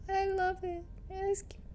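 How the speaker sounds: gasp, then high, soft gushing; tiny high squeal of adoration; adoring coo. Real, not acted. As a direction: coo over something cute, high and quiet. Gushing over something cute in game.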